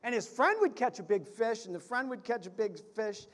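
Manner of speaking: usual speaking voice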